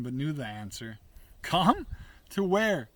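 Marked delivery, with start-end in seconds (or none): 1.4-2.9 higher, louder challenge; incredulous retort. A narrator's reading. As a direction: throw the question back sharply. Scornful reply to the invitation.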